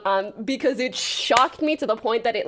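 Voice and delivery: ordinary delivery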